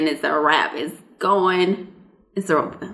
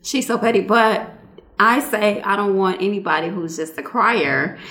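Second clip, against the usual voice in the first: ordinary delivery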